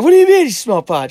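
high, loud smartass innocence; mock innocent. Son playing dumb to his mom, loud and high.

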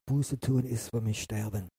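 normal talking voice

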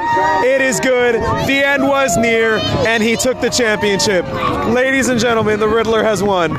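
excited play-by-play announcer; race commentator. Pretend. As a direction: call the race like a sportscaster.

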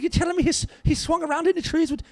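high-pitched incredulous barman; hard-ass Irish bartender. Retold or imitated. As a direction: play the Irish bartender demanding confirmation. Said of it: "real hard-ass look, looking Irish bartender".

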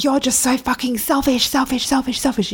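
high-pitched imitation of an angry rant; mock angry rant. Ex-partner calling her selfish over and over, imitated angry rant. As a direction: hammer the repeated selfish like a sneering accusation. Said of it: "And he said".

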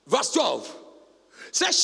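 usual speaking voice

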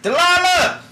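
loud high hollering across the ship; yelling a name. Acted. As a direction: holler for her from above. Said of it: "he yells".

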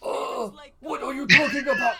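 lazy, hammed-up old rat master; Splinter voice. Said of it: "at doing a Splinter voice ever".

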